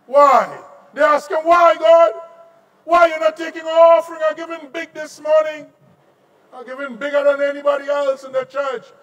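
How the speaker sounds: high, loud demanding why; loud pleading question. Acted. Men in church loudly asking God why.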